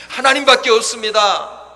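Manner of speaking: loud, high supplication; pleading cry. Acted. Believer pleading before God, loud pleading cry. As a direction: cry it out as an earnest prayer. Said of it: "간구하면서".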